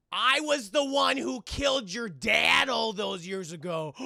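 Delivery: loud, slow, hammed-up declaration; villain's big reveal. Ricky Tan confessing to Jackie Chan, loud melodrama. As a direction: proclaim it like a gloating movie villain.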